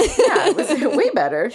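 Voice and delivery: high, loud ironic quip; sarcastic. Real, not acted. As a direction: throw it out sarcastically. Joking sarcasm.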